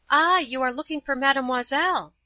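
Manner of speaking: a hint of a little child; childish voice. A narrator's reading. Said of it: "said a childish voice".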